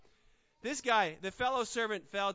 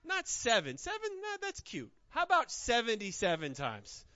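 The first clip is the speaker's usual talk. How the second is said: quick, teasing, a bit higher; playful Jesus; 5 semitones higher, 4 dB louder than usual. Retold or imitated. Lightly voiced Jesus teasing Peter about counting, playful. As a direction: toss off the number like a challenge. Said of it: "Jesus is like".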